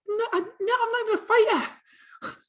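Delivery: very high, squeaky mock protest; incredulous self-mockery. Laughs at himself for thinking of fighting, high-pitched disbelief. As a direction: shoot way up in pitch, ridiculing yourself. Mocking his own fighting thought.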